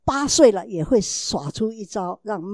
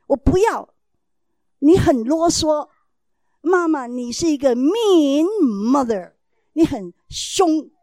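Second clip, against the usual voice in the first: higher, louder, slow whiny protest; sulky child talking back; 5 semitones higher, 8 dB louder, slower than usual. Retold or imitated. Son whining back at his mother, slow and loud. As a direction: play the bratty kid calling mom mean.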